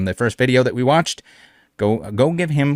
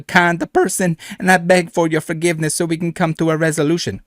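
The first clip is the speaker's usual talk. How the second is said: hammed-up French cowboy accent, pleading and higher; mock French cowboy accent; 4 semitones higher than usual. Voicing the thief's groveling apology message, mock French cowboy accent. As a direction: ham up a French cowboy begging for mercy. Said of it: "give him the French cowboy accent".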